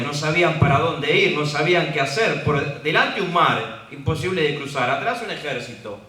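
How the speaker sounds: loud, driving sermon delivery; preaching at full force. Acted. Preacher rousing the church, loud and urgent. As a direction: press the danger home to the congregation. Preacher describes Israel trapped.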